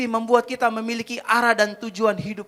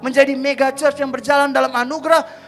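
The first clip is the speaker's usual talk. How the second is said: loud, raised, driving sermon delivery; fervent preaching; 6 semitones higher, 10 dB louder than usual. Real, not acted. Preacher declares his zeal to the congregation, fervent preaching. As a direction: build up and proclaim it with full passion.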